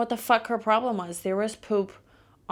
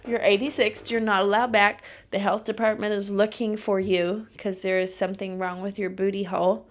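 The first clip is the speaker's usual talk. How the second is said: banning rant; 5 dB louder than usual. Retold or imitated. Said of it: "be like".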